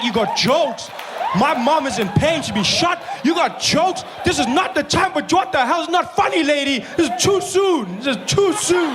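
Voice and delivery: high-pitched indignant rant; outraged. Retold or imitated. Customer scolds pharmacist joking about his mom, high outraged shouting.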